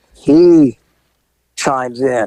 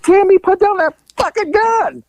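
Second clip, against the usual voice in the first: high, urgent command; commanding order. Retold or imitated. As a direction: order her to drop the gun. Cop orders woman with shotgun.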